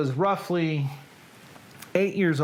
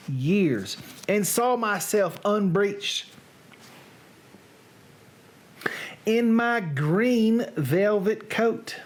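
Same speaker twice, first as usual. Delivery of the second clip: put-on Southern accent. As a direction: recite it theatrically in a Southern accent. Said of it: "read now in a Southern accent".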